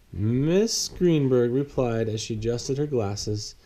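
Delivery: ordinary delivery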